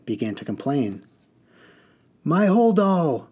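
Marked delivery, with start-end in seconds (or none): 2.2-3.3 high, loud, slow yell. Acted. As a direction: wail about your bag. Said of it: "yelled a mud woman".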